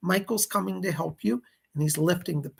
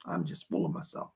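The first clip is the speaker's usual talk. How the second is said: a breathy self-mocking whisper; whispered aside; 8 dB quieter than usual. Real, not acted. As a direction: whisper it, lower, to yourself.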